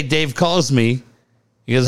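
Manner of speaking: usual speaking voice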